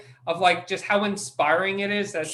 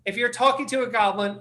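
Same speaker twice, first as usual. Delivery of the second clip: ordinary talk, nothing marked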